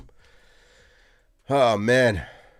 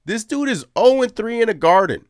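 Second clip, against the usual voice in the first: loud, high, incredulous rant; 11 semitones higher, 7 dB louder than usual. Real, not acted. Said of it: "that was my little rant".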